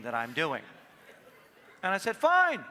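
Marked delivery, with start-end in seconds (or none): 2.2-2.6 loud, high, defiant fine. Retold or imitated. Husband answering wife's dare, a loud defiant fine. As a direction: throw down the challenge.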